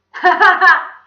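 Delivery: very loud, high cackle; piercing, delighted acted laugh; child's laugh. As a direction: burst into gleeful kid laughter. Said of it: "Todd laughed".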